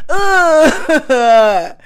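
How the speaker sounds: loud laughing groan at herself. Real, not acted. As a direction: groan through a real laugh at the flub. Laughing at her own flub.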